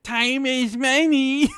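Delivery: high, drawn-out goblin parody; slow, exaggerated mockery of his goblin. Host mocking all the donor will get, exaggerated goblin voice. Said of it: "all he's gonna get is".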